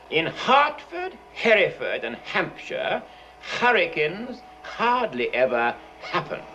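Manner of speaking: slow, heavily breathed H on "Hertford", "Hereford", "Hampshire"; H's pronounced. Shown as a demonstration. Teacher demonstrating before a flame, exaggerated H's. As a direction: puff out every H in "hurricanes hardly ever happen". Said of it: "pronounce the letter H correctly".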